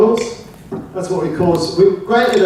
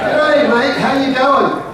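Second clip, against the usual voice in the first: higher-pitched, run-together Australian hello; broad Aussie greeting; 4 semitones higher, slower than usual. Shown as a demonstration. Showing neighbours how to greet, broad Australian accent. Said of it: "It's "G'day".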